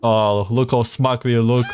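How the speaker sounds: normal talking voice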